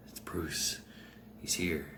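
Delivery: low, hushed crowd murmur. Acted. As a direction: murmur it low like an onlooker in awe. Warriors murmur as Bruce passes.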